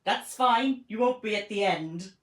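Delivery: lightly mock Welsh accent; hint of spooky Welsh guide. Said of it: "Welsh accent".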